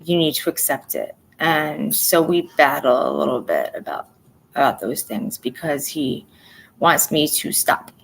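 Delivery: ordinary delivery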